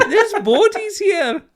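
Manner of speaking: high, slow, laughing Scottish horror; Scottish accent. Retold or imitated. Describing the church basement massacre in a hammed-up Scottish accent. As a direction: draw it out in a shrieking Scottish voice. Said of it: "in this Scottish accent".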